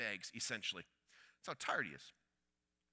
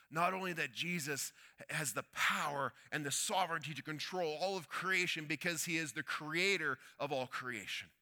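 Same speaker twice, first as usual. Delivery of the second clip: normal talking voice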